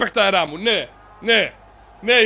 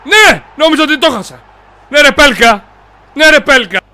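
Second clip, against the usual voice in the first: loud, higher celebration; jubilant shout; 7 semitones higher, 6 dB louder than usual. Real, not acted. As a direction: cheer the goal with relief. Celebrating a goal. (πανηγυρική φωνή)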